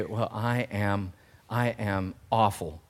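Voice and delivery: slow, low and soft, mock dejection; lightly acted gloom, voice sunk; downcast self-talk. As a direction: quietly admit it, as if defeated. Voicing a downcast believer's self-talk.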